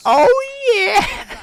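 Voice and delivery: high squeaky mock cheer; mock squeal. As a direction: squeal it like a ditzy clerk. Mock cheer ending the parody.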